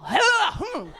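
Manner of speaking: loud, high startling "huh" then hum; Halloween scare noise. Acted. Comic acting out men's Halloween scare, mock spooky noise. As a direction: jump out with a spooky yelp. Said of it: "I call it the Halloween scare".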